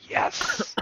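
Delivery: hushed, relieved mini-celebration; whispered triumph. Retold or imitated. Dad escaping blame for Max's swearing, whispered triumph. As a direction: whisper it like a secret victory.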